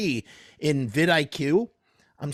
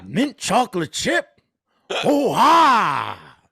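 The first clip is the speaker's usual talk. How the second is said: slow, louder, growing excitement; Al Pacino impression; 2 semitones higher, 5 dB louder, slower than usual. Impression of Al Pacino ogling Baskin Robbins flavors. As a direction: marvel loudly at the ice cream flavors. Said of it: "my impression of an impression".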